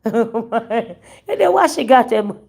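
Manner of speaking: slow words broken by laughter; laughing while talking. Real, not acted. Giggling to her viewers as she asks. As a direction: laugh through the question.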